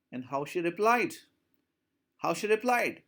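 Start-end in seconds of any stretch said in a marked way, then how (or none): none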